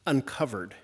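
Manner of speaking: normal talking voice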